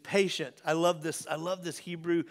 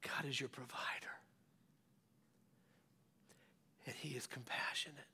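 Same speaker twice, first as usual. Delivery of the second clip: hushed, breathy whisper of comfort; 4 semitones lower, 18 dB quieter, faster than usual. Real, not acted. Pastor whispering comfort to the congregation. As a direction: lean in and whisper it gently.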